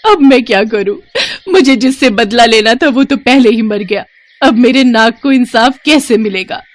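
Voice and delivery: high, anguished crying voice; loud tearful wailing. Acted. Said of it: "जोर-जोर से रोने". (ज़ोर-ज़ोर से रोते हुए)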